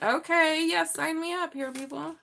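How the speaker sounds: sign-me-up excitement; eager yes. Real, not acted. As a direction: jump at the offer. Eager reaction to wish card.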